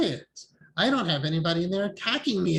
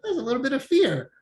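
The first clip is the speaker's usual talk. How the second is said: mild surprise; 9 semitones higher, 4 dB louder than usual. Retold or imitated. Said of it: "It's like".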